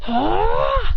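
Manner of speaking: high rising battle yell; battle cry. Acted. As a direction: let out a high "ah" that climbs. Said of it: "As they're going".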